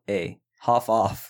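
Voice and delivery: put-on slow drawn-out Canadian accent. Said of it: "Canadian accent".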